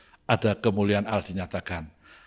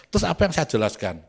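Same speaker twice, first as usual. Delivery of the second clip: ordinary delivery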